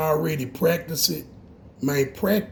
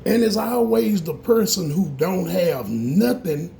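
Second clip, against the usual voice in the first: ordinary delivery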